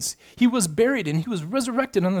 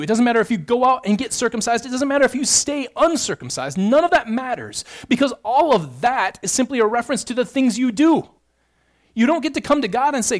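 raised, pressing sermon delivery, higher and louder; impassioned preaching; 5 semitones higher, 4 dB louder than usual. Acted. Preacher pressing the congregation on boasting, impassioned preaching. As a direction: drive each point home like a revival preacher.

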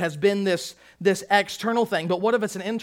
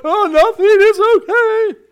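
high, loud hammed-up weepy voice; comic blubbering dad, last words drawn out; mock blubbering; 11 semitones higher, 11 dB louder, slower than usual. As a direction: pretend to bawl while insisting you're fine. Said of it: "makes you a blubbering idiot".

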